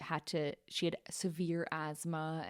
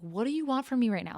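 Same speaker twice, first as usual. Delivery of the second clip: put-on fast, louder, snippy teen; mean to mom; 6 semitones higher, 4 dB louder, faster than usual. Teen snapping at her mom, mean and impatient. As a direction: snap back impatiently at mom. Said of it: "I was being super mean to my mom".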